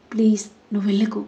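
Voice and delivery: fast, hushed begging; whispered plea. Acted. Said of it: "బతిమాలడంతో".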